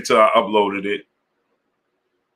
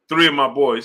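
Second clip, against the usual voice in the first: usual speaking voice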